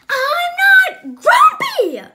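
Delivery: screamed very high and very loud; furious shout. Acted. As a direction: roar it, beating your chest. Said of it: "shouted Jim as he beat his chest".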